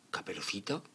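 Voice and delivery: ordinary delivery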